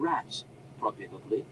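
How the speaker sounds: plain reading voice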